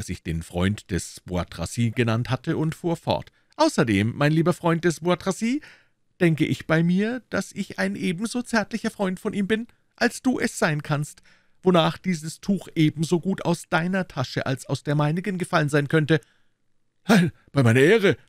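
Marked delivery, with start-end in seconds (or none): 3.6-16.2 high, smooth musketeer voice; Aramis. A narrator's reading. Musketeer turns on Bois Tracy's friend, high and arguing. 17.1-18.2 loud, higher protest; called out. A narrator's reading. Guardsman protesting on his honour, calling out. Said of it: "rief der Gardesoldat seiner Majestät".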